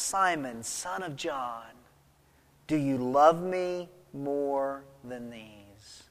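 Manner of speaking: slow, soft and low, tenderly probing; gentle questioning. Acted. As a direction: ask it softly, with weight on each phrase. Jesus gently questioning Peter, acted.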